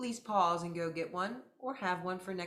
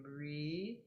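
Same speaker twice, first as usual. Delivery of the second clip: usual speaking voice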